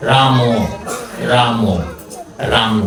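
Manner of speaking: রাম said with a full অ vowel after the ম, like "রামো"; রাম ending open on অ; রাম with final vowel. Shown as a demonstration. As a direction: sound the vowel at the end of রাম. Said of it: "এই রামটা কিন্তু স্বরান্ত".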